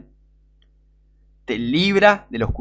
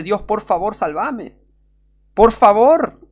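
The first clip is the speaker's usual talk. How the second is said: desperate plea; 6 semitones higher, 3 dB louder, slower than usual. Shown as a demonstration. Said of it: "y grita y, y dice".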